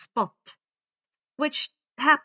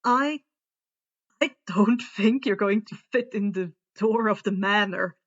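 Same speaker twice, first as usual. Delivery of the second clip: wry; 5 dB louder than usual. A narrator's reading. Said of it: "Graham remarked wryly".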